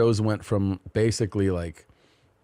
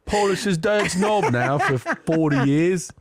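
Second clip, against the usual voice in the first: slow, mock cockney lady voice; hammed-up British gossip; fake English accent; 9 semitones higher than usual. Husband mocking the royals in a slow fake English accent. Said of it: "fake English accent".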